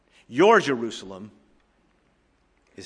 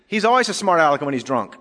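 raised voice, mock complaint; yelling; 5 semitones higher, 7 dB louder than usual. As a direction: loudly tell on him, as in a joke. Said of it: "yelled a woman in the passenger seat".

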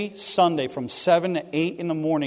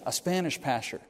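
low confiding aside; 3 semitones lower, 10 dB quieter than usual. Retold or imitated. Pastor murmuring the job to a student, low and confidential. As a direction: lower and softer, leaning in. Pastor leans across the table.

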